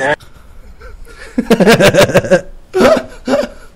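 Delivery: high bursting laughter; loud burst of laughter. Real, not acted. Friend cracking up at his buddy's story, laughing hard. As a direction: throw your head back and laugh out loud.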